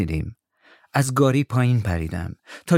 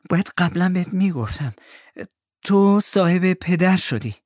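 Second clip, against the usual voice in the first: breathless; 9 semitones higher than usual. A narrator's reading. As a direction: confess breathlessly, pitched high. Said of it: "نفس بریده گفت".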